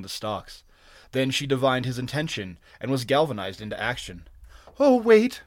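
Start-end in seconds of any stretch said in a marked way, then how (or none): 4.8-5.4 high, loud pleading cry; desperate wail. A narrator's reading. Captive woman calling after departing stranger, wailing. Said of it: "she wailed".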